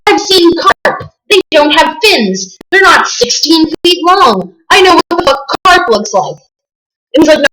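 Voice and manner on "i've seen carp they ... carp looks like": high, heated mock protest; indignant rant. Arguing with the fat guy in the boat, high indignant mock rant. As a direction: insist hotly that you know carp.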